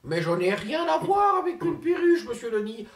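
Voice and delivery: slow indignant shout, high; shouting in mock outrage; outraged. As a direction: bellow the denial, offended. Outraged denial about parakeet.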